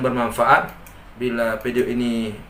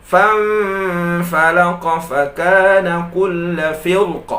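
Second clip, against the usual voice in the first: higher chanted tilawah; Quran recitation; 5 semitones higher, 5 dB louder than usual. Acted. Teacher recites the Quran verse for students, chanted.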